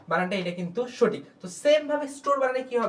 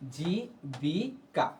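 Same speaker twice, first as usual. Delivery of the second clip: very slow and softer, drawn out; slow repeat; 4 semitones lower, 7 dB quieter, much slower than usual. Shown as a demonstration. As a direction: let the word sink in. Teacher repeats the example word.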